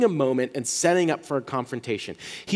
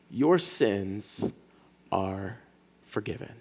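lower, much softer, slow and gentle; tender forgiveness; 7 semitones lower, 6 dB quieter, much slower than usual. Acted. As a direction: lay it down softly like a blessing. Jesus speaks to paralyzed man.

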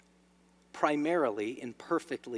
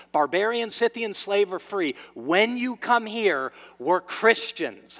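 raised, emphatic sermon delivery; fervent preaching; 5 semitones higher, 6 dB louder than usual. Acted. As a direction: preach it with conviction, driving the key words home.

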